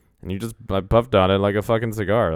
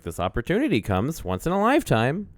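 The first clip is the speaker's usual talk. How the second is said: higher, sing-song teacher voice; imitated teacher talk, pitch lifting at the end; teacher to small child; 7 semitones higher than usual. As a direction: say it like encouraging a little kid. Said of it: "like a teacher says it to, like, a small child".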